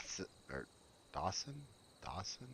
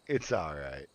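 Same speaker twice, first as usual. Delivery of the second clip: "It's all right" dropped low and soft; lower soothing take; 9 semitones lower, 4 dB quieter than usual. Shown as a demonstration. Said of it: "Let me go lower".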